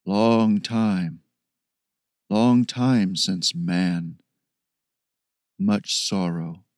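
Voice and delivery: slow, low, halting ape-man. Acted. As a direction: speak slowly like a gentle beast.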